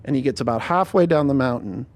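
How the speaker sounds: ordinary talk, nothing marked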